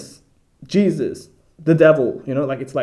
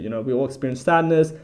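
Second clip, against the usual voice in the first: normal talking voice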